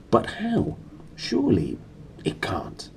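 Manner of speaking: disbelieving whisper. Acted. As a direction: whisper it in shock.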